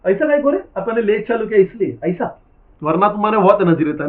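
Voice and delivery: loud, high teasing scold at lazy students; scolding the class. Real, not acted. Teacher scolds students for being sluggish before exam, loud scolding. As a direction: raise your voice and tell them off.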